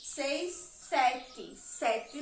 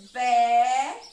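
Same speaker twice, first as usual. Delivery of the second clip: "Vé" drawn out loud, open vowel; loud, stretched single syllable "Vé"; syllable marking; 10 dB louder than usual. Shown as a demonstration. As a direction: hold "Vé" long and loud on its own. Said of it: "eu vou marcar a sílaba".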